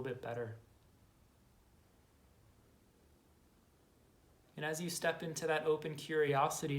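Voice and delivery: measured, calm inner-journey guidance with long silences; guided meditation. Acted. Guide to listeners exploring their inner saboteur, calm meditation voice. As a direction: invite quiet noticing, letting phrase endings settle softly.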